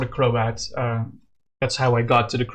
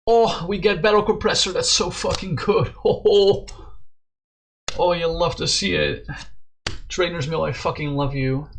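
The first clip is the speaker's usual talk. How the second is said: excited; 4 semitones higher than usual. Real, not acted. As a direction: burst out with real delight. Great card drawn in a game.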